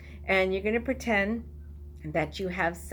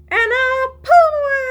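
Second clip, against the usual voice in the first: high, loud squeal on away; squealed climax; 12 dB louder, faster than usual. Shown as a demonstration. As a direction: burst up high and loud as the hands come free. Climax of action song.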